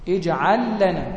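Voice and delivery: اجعل لنا said slowly with the lam doubled into لنا; lam merged, slow demo. Shown as a demonstration. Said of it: "مثال". (نطق اجعل لنا ببطء مع إدغام اللام في اللام)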